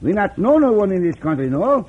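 put-on broken English in a Mexican accent; lighthearted sidekick in a Mexican accent. Pancho speaks broken accented English.

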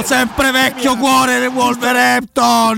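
high, slow cheering chant; excited cheer. Real, not acted. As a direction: cheer it out, drawn out. Cheering a goal live.

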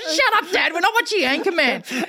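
put-on high-pitched jeering kids, half laughing; mock bratty retort. Kids refusing Dad's film, mock bratty retort. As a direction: imitate kids shouting Dad down. Kids yelling back at dad.

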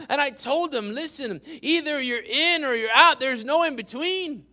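forceful preacher's cadence; preaching. Real, not acted. Pastor urging the church to be set apart, preaching. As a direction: punch the key words like a preacher.

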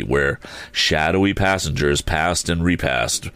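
ordinary delivery

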